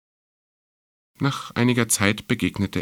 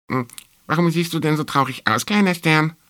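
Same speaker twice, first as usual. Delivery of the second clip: high, squeaky little mouse; mouse character; 8 semitones higher, 4 dB louder than usual. A narrator's reading. Mouse asking the little star about its sadness, high-voiced. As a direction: pipe up high as a curious mouse.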